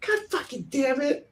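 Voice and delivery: high, small child impression; little girl's voice. Recalling what sister heard Lupin say, high child voice. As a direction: mimic a tiny kid cursing. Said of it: "when my sister heard, like, Lupin say".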